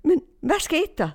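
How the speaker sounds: high-pitched, puzzled calling out; retold astonished call. Woman sees Ibrahim holding her keys, retold astonished call. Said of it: "Og så råber jeg".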